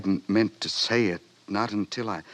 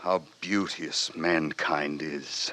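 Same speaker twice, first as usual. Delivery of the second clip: hushed awe; 3 semitones lower, slower than usual. Acted. [name] quotes Shakespeare in awe.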